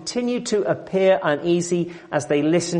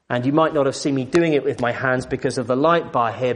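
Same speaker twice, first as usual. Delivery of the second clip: ordinary talk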